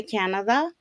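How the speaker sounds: ordinary talk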